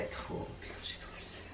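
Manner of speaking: whispered gripe, barely voiced; frustrated whisper. Real, not acted. Vlogger whispers to herself that she's gutted, frustrated. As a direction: breathe the complaint out, annoyed. Grumbling about the jeans.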